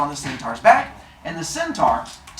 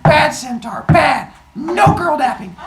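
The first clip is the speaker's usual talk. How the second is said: higher, louder mock scolding like to a dog; 11 semitones higher, 6 dB louder, slower than usual. Wife kicking the centaur, scolding him loudly. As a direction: tell off a naughty pet.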